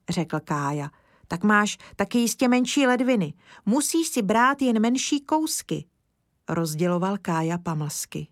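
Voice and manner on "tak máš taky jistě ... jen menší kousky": put-on high, eager child's voice; little boy. Kája explaining to Mr Lesní, high childlike voice. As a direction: speak up like an earnest small boy.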